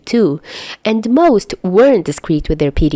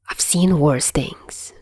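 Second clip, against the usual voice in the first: quiet mutter; 3 semitones lower, 5 dB quieter, slower than usual. Acted. Chuya muttering to no one.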